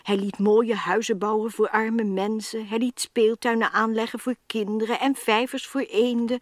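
usual speaking voice

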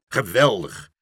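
loud, delighted exclamation; father cheering his son's idea; shouting. A narrator's reading. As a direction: cheer the plan. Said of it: "schreeuwde vader Stamper".

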